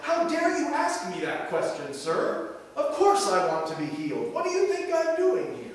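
mock angry answer. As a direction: play the offended beggar snapping back, half acted. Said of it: "almost angry answer".

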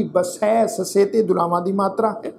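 ordinary talk

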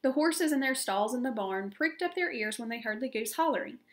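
ordinary delivery

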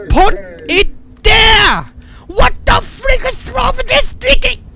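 loud, high outburst of exasperation; furious frustrated shout. Real, not acted. Player fighting the sticky controls, frustrated shouting. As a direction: yell it, leaning hard on each word.

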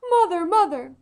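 put-on loud, high child's cry of Mother; a toddler wailing after his mother down the stairs. A narrator's reading. Toddler calling after departing mother, loud high cry.

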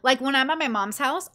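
ordinary talk

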